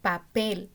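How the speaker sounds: "papel" with "pel" stressed and falling; stress on last syllable. Shown as a demonstration. Teacher repeats the example for students, stressing "pel" in "papel". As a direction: land firmly on the "pel" of "papel". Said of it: "el acento está en la última sílaba".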